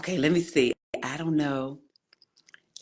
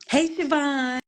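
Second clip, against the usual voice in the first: much higher hey; excited call-out; 11 semitones higher, 3 dB louder, slower than usual. Real, not acted. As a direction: sing out the name. Host calls out to viewer.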